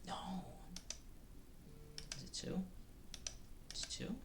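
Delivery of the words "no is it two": normal talking voice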